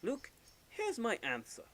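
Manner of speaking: a touch of deliberate, stately announcement; Professor Layton. Acted. Professor telling his young companion the answer, deliberate. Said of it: "for Layton, it's normally".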